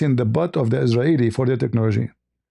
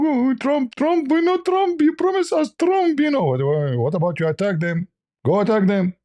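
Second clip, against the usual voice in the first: mock pleading Qatar; 11 semitones higher than usual. Qatar begging Trump to attack, much higher mock whine. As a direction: whine for a protector like a helpless child.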